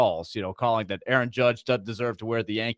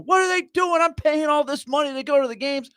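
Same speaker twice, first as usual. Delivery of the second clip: put-on much higher irate New York caller accent; two-bit New York voice; 13 semitones higher than usual. Host mimics an irate New York fan calling a talk show, in a cheap New York accent. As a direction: play an angry New Yorker ranting on a call-in show. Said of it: "that's my two-bit New York voice".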